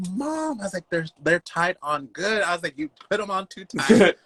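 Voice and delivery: high-pitched retold whine to mom; mock complaint. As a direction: imitate yourself protesting to your mother.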